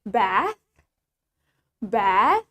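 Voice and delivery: "bath" with the A drawn out, rising; British "bath", hold the vowel long; low model "bath" with a lengthened A. Shown as a demonstration. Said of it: "we usually pronounce the A longer".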